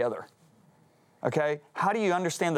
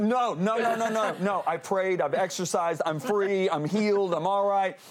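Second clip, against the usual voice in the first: flustered protest; 5 semitones higher, slower than usual. Retold or imitated. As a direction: push back fast and defensive. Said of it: "I jumped up".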